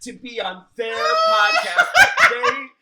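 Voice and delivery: high, loud, laughing gratitude; laughing gush. Real, not acted. Gushing thanks, laughing shout.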